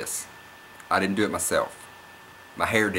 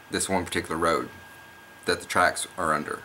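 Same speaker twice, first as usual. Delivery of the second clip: ordinary talk, nothing marked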